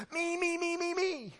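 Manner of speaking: high, squealing me me me; a child on tiptoes begging to be picked; eager first grader. Acted. First grader volunteering for the teacher, high and squealing. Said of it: "first graders who ... wave their hand".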